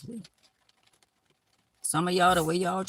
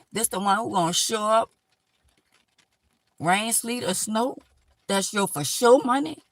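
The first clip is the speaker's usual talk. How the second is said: rising indignation; 5 semitones higher, 5 dB louder than usual. Real, not acted. Scolding an overcharging hairdresser.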